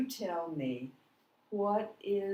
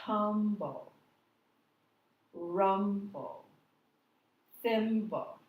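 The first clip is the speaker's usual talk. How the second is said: Tumble, rumble, thimble, each first syllable longer and higher; stress on first syllable; 4 semitones higher, 5 dB louder, much slower than usual. Shown as a demonstration. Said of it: "the first syllable is said a, a little longer".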